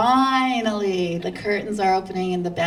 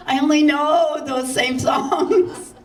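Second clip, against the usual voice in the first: higher, slow, laughing out a nervous protest; 7 semitones higher, 4 dB louder, slower than usual. Retold or imitated. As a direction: laugh through the excuse. Panicked laughing reply to host.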